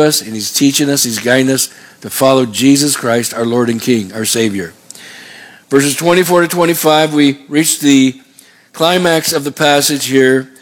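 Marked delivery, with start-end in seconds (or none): none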